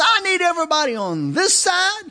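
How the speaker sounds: customs lady's announcement. Retold or imitated. Customs lady calling to the queue, loud and high. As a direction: project like an agent ordering a line. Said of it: "she says".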